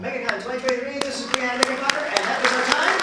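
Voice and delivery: loud, high hype host; announcer voice. Acted. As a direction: announce it to the crowd. Host wraps up panel.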